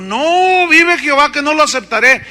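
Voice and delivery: high, loud emphatic no; firm refusal. Retold or imitated. Eliseo refusing Naaman's payment, high and loud. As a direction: refuse outright, ringing. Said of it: "Y dijo".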